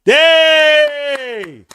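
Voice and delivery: high, loud sarcastic yay; mock celebration yell; shrill mock cheer. Real, not acted. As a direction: cheer mockingly at the blunder. Mocking cheer.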